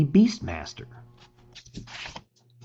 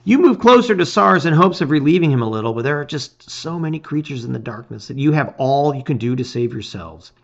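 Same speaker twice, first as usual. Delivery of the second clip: plain reading voice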